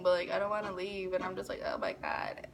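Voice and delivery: soft, fading to unvoiced; hushed panic. Girl hushed while her crush lies on her. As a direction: breathe it out in shock.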